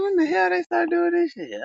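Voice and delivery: very high, squealed disbelief; incredulous squeal. Retold or imitated. Reacting to Jack's selling chicken nuggets, incredulous squeal. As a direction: demand it in a retold high squeal. Retells surprise at new menu item.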